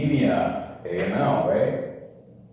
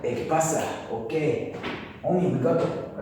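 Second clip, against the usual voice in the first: put-on Spanish accent; 7 semitones higher, faster than usual. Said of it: "Spanish, Spanish".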